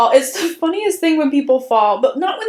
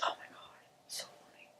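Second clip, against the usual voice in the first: whispered; 31 dB quieter, faster than usual. Real, not acted. Breathless after laughing fit.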